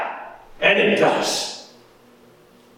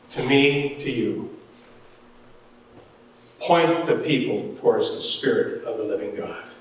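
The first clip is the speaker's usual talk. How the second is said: ordinary talk, nothing marked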